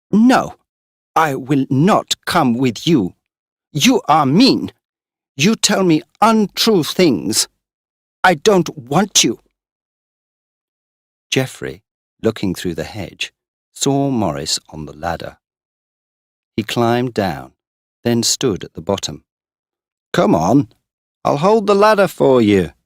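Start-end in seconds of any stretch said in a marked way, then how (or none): 0.1-9.4 high, loud, slow refusal; angry refusal. Acted. Girl refusing Morris, loud slow anger. 20.1-22.7 high, louder call. Acted. Geoffrey calling up to the girl, loud. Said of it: "he called".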